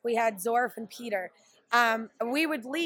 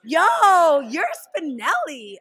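excited shout; 6 semitones higher, 11 dB louder, slower than usual. Retold or imitated. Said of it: "and they were like".

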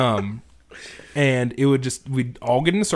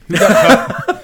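laughing question; 11 semitones higher, 9 dB louder, slower than usual. Real, not acted. Laughing disbelief at teammate.

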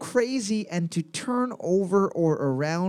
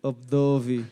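a lower, lightly put-on Russian accent on Obdovi; mock Russian accent; 6 semitones lower than usual. Host showing off his mock Russian accent to the co-host. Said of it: "my Russian accent is on point".

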